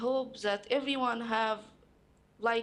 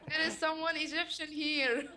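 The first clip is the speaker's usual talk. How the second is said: laughing through the words, pitch raised; 5 semitones higher than usual. Real, not acted. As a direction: giggle it out, delighted at being understood. Amused at a listener's Arabic.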